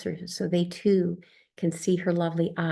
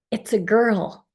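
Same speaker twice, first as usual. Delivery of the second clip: joyful announcement; 3 semitones higher, 6 dB louder, slower than usual. Retold or imitated. Imagined birth announcement.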